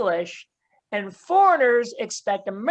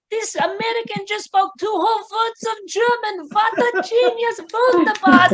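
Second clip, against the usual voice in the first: high, hammed-up German accent, gushing praise; mock German gushing; 7 semitones higher than usual. Germans fussing over the American tourist, high mock German accent. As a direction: play an overjoyed German host, high and thick German accent. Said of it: "Germans will shout".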